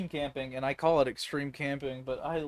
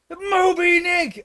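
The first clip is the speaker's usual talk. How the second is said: high, loud drawn-out holler; mock whaling cry; 12 semitones higher, 9 dB louder, much slower than usual. Campers teasing a friend about weight, long high mock shout. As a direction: stretch it out like a lookout's call.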